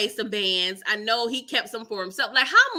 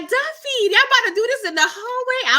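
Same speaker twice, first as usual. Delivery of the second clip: high, loud disbelief; shocked call-out; 12 semitones higher, 6 dB louder than usual. Retold or imitated. Vlogger shocked at Duffy in the hallway, loud call-out.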